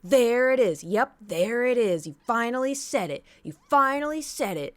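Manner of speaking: imitating the neighbor yelling at her partner on the phone; mock furious neighbor. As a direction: shout it like a neighbor catching him out. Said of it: "She goes".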